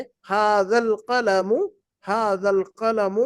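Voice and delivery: ھذا القلم read slowly with clear stress; slow model reading. Shown as a demonstration. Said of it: "nine کو دیکھتے ہیں". (آہستہ پڑھ کر سکھانا)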